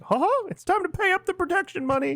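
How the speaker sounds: very high falsetto cartoon mouse; Mickey Mouse. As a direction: squeak it like a cartoon mobster shaking someone down. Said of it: "You think Mickey goes down to Texas".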